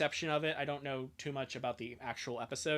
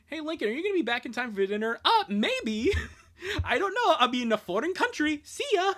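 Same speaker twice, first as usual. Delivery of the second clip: cartoon kid voice; 11 semitones higher, 7 dB louder than usual. Voicing comic characters, high and loud.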